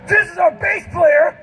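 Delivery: high, hammed-up frontman shout; mock stage announcement. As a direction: introduce the band to a roaring arena. Mimics own stupid stage announcements.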